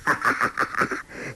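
rolling staccato "ha-ha" cackle; villainous laugh. A narrator's reading. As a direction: laugh smugly before boasting. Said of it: "欧阳锋笑道". (得意大笑)

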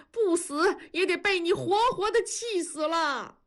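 high-pitched tearful wail of reproach; acted crying tirade, pitched well up. A narrator's reading. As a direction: wail and scold in one long, high outburst. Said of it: "大壮开始哭喊".

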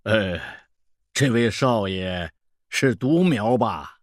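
put-on slow, drawn-out old fortune-teller; old peddler. Old peddler probing a young customer, knowing. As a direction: size them up with a leisurely drawl.

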